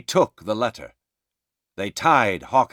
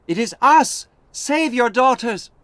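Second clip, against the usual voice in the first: high, loud calls for her father; desperate shout; 14 semitones higher, 5 dB louder than usual. A narrator's reading. Alice calling to her father on the wall, desperate shouting. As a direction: cry out to be recognised. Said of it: "Alice shouted".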